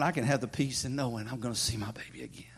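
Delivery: unvoiced whisper, soft and low; whispered hope. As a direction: breathe it out gently. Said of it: "He said".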